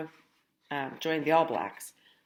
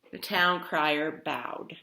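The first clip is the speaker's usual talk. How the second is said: ordinary delivery, nothing marked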